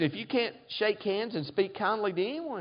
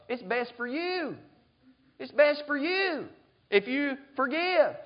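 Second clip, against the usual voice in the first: normal talking voice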